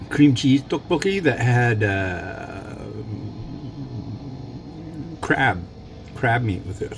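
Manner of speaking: ordinary delivery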